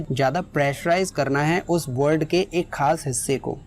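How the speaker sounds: usual speaking voice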